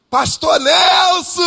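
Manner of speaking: high shouted call across the room; loud call. Retold or imitated. Makeup artist calling the pastor across the salon, shouting slowly. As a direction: call out his name loudly and stretched. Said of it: "Falando alto".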